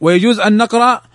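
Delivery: normal talking voice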